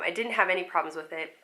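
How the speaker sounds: ordinary delivery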